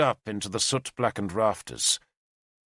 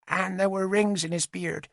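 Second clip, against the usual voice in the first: put-on high, small child's voice, reciting; a nine-year-old saying rehearsed words; young girl; 9 semitones higher than usual. Little witness telling the lords her loss, childlike recital.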